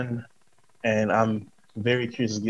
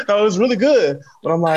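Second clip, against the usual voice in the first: laughing excitement; 10 semitones higher, 7 dB louder, faster than usual. Real, not acted. Host still laughing, excited.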